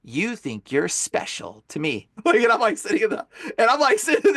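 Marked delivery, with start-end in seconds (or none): 0.0-1.6 slow, low demon voice. Retold or imitated. Demon taunts exorcist in his head, low and slow. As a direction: taunt slowly in a lowered demon voice. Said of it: "the demon goes".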